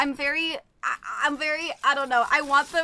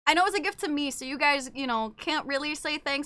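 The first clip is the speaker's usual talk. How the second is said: ordinary talk, nothing marked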